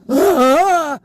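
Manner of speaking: a high, startled "Ah!" from the onlookers; shocked gasp. Retold or imitated. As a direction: gasp like you caught them in the act.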